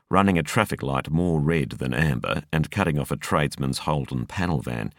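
ordinary delivery

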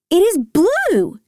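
high, drawn-out BLUE; excited cry, stretching "blue"; excited realisation. A narrator's reading. Excited repeat on recognising colour.

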